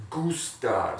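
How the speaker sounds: "gustar" with a hard g. Shown as a demonstration. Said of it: "Listen to that sound".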